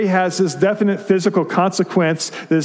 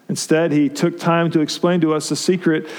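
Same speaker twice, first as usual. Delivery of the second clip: ordinary delivery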